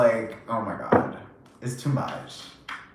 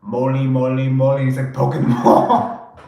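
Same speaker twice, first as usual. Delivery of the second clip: Austin Powers quote; 4 dB louder than usual. Retold or imitated. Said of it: "Austin Powers".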